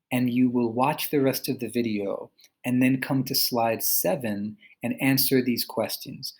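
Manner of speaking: normal talking voice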